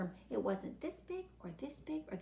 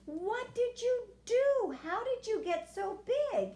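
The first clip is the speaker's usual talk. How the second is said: put-on high, slow astonished old man; old man amazed at the huge worm; shocked old man; 9 semitones higher, 4 dB louder, slower than usual. A narrator's reading. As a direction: gasp it out as a startled old man.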